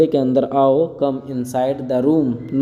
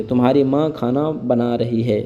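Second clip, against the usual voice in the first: normal talking voice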